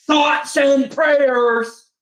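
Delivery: "thoughts and prayers" drawn out very slowly and pitched high; sarcastic thoughts and prayers. Retold or imitated. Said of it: "I'ma do the exact same shit to you".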